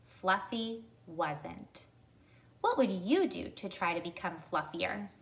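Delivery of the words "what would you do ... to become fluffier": ordinary delivery, nothing marked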